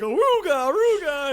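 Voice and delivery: high, loud "aruga" whoops; whooped aruga, falling off each time; cartoon aruga horn. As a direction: imitate a cartoon eye-popping horn. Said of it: "eyes pop out ... going like".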